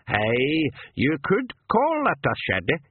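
put-on old pixie voice. A narrator's reading.

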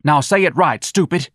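high, loud scolding; angry Lana. Acted. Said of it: "shook Darlene a little".

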